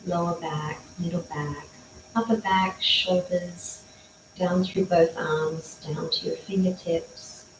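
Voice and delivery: soft, calm body-scan voice; guided meditation. Acted. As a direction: lull them gently through the body.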